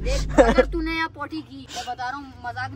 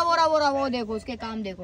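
normal talking voice

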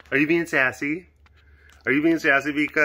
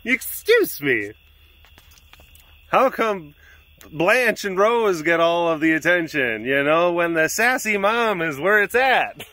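higher, slow, drawn-out sassy excuse me; sassy cat voice; 5 semitones higher than usual. Pretend. Owner voicing Raven complaining about the other cats. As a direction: voice the old cat demanding attention. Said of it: "Raven is like".

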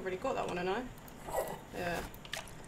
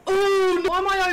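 panicking gamer; 6 semitones higher, 7 dB louder than usual. Real, not acted. Scare in horror game.

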